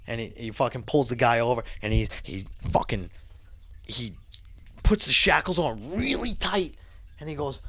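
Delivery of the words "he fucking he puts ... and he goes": ordinary delivery, nothing marked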